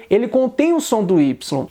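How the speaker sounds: ordinary delivery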